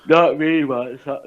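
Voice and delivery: words stretched by a yawn. Real, not acted. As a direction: talk through a yawn. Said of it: "sorry, excuse my yawn".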